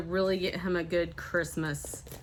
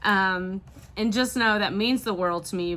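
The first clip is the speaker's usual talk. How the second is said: ordinary talk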